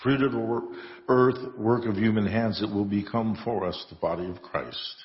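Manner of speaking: ordinary talk, nothing marked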